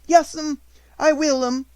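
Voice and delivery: barely holding back tears; trembling. A narrator's reading. Maid answering the headmistress, high and trembling.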